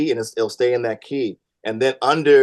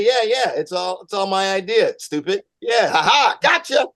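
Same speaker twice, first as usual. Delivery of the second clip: mock cover-up; 7 semitones higher than usual. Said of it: "he tried to say".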